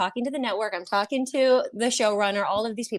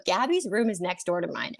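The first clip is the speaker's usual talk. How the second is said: ordinary talk, nothing marked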